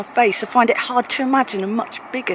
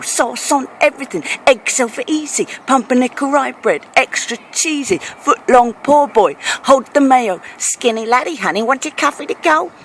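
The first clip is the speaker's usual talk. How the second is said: higher-pitched mock American diner patter; imitated American; 4 semitones higher than usual. Brit mimicking American food orders, mock American accent. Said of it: "you say".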